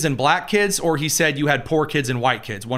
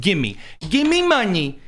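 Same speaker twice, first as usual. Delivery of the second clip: whiny victim voice; 8 semitones higher, slower than usual. Acted. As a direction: whine for money like a spoiled brat. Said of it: "I did the voice for the Discord crew".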